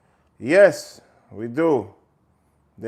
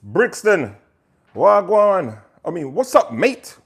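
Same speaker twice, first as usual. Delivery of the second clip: a loud, higher-pitched mock South London Brixton accent; Brixton accent impression; 6 semitones higher, 6 dB louder, slower than usual. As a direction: throw out a cheeky Brixton greeting in a put-on South London accent. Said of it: "how other Brixton, um, accent goes".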